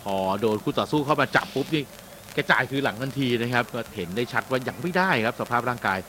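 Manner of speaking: normal talking voice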